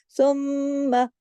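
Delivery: सुम्मा with a long hummed म्म; big nasal hold. Shown as a demonstration. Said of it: "अकमल गुन्ना". (सुम्मा, बड़े गुन्ने के साथ)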